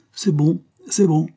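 high, quick brush-off; hiding emotion. A narrator's reading. Father dismissing thanks so as not to show he is moved, brusque. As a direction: wave away the praise to cover feeling. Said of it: "ne voulait pas avouer combien il se sentait ému".

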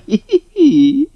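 high, slow, sly giggle; giggling fox laugh. Acted. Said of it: "покатился по полу со смеху".

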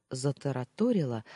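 ordinary delivery

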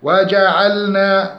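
slow وجعلنا with a held l; chanted waja'alna, lam distinct; clear lam. Shown as a demonstration. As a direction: keep the l of وجعلنا from sliding into n. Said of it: "توسط في اللام".

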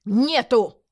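loud, exasperated bark; short, forceful shouted refusal; thunderous bark. Acted. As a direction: roar it into a deaf man's ear. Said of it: "громогласно рявкнул мужчина старику".

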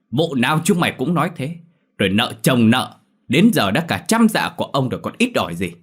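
narrated villain's angry tirade, mildly acted; angry hiss. A narrator's reading. Landlord berating debtors and ordering his men to search, angry. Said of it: "Ông Chiến rít lên".

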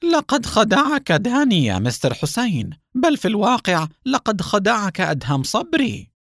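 high, irritated cut-in; annoyed. Acted. Haim interrupts Hussein, irritated. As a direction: snap it over him, pitch up. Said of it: "قائلا بضيق".

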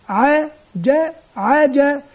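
"عا، جا،" spelled out slowly, syllable by syllable; slow spelling. Shown as a demonstration. Teacher showing pupils how to spell, slow syllables. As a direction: sound out each syllable slowly for the class. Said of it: "كيف نتهجى؟".

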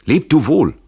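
loud, high farewell shout; urgent call. A narrator's reading. Fugitive bids his friend farewell in flight, urgent call. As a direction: call goodbye at the top of the voice. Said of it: "ruft Tränk".

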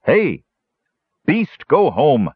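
high, drawn-out scolding call; scolding shout. A narrator's reading. Numskull scolding the creature across the cave, shouting. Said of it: "shouted Numskull".

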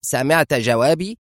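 high, sharp rising question; pointed, impatient check. A narrator's reading. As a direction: challenge him sharply, pitch climbing. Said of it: "قائلة في حدة".

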